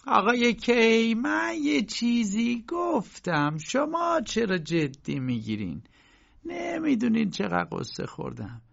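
very high, drawn-out protest; landlady pleading her case, high and slow. A narrator's reading. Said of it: "با صدای بلند گفت".

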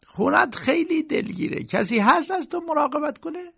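high-pitched uncle questioning his sick friend; visiting uncle. A narrator's reading. (صدای زیر عموی پرسشگر)

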